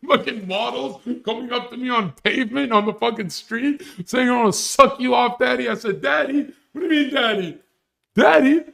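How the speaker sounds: high-pitched, hammed-up disbelief; comic high voice, giddy and disbelieving; incredulous rant. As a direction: squeal it in mock amazement. Hammed-up incredulous retelling of models' advances.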